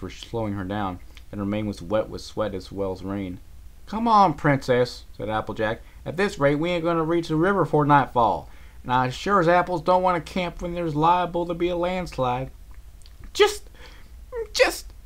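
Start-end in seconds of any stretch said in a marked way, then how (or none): 3.9-12.5 higher, louder country urging. Acted. Applejack hurries Rarity before nightfall. 13.3-14.9 very high breathless gasps; panting. Acted. Rarity pants, trying to keep up. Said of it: "Rarity panted".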